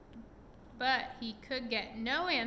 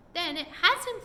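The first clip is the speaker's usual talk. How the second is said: higher and louder relieved cry; cried out; 8 semitones higher, 8 dB louder than usual. A narrator's reading. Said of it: "cried Molly".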